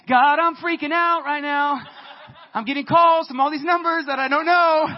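high, loud, slow anxious plea; panicked prayer. Retold or imitated. Preacher voicing his own frantic prayer, loud plea. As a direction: pray as if in a panic.

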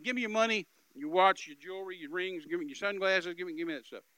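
loud, pushy robbery demand; gunman's demand. Retold or imitated. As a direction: demand it like a stick-up man.

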